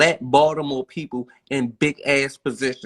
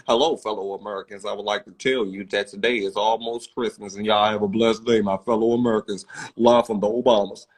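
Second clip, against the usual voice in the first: lower-pitched, measured presidential address; Barack Obama; 3 semitones lower than usual. Retold or imitated. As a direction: play the president greeting the nation, voice dropped. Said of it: "Barack Obama talk like me".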